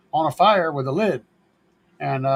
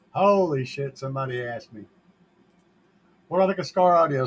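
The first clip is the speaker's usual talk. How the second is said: usual speaking voice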